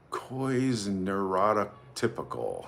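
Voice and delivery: slow, careful go at a hard name; sounding out. Real, not acted. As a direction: feel your way slowly through "Coy's neurotypical". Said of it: "It's a hard one to pronounce".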